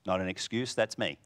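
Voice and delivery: ordinary talk, nothing marked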